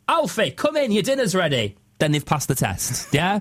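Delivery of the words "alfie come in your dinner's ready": high opening, falling northern dad call; calling children in. Acted. Lancashire dad testing a name outside, calling out. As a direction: holler convincingly to a child outside. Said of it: "convincingly come outside and go".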